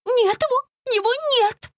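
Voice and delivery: high, loud panicked cry; frantic woman's scream. A narrator's reading. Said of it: "закричала Настя".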